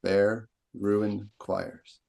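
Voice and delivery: very slow, bare and ruined both hammered; two heavy beats, then choirs; spondee stress. Shown as a demonstration. Teacher demonstrating the spondee, slow and weighty. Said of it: "Stress, stress. Hard accent".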